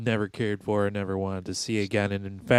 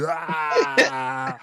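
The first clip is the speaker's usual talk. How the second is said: stoner yelp. Retold or imitated. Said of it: "He did that".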